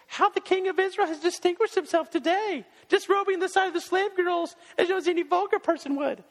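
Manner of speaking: high, mocking sneer of a scornful wife; sarcastic mockery. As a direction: sneer the praise, dripping with sarcasm. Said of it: "greets him with a bowl full of sarcasm".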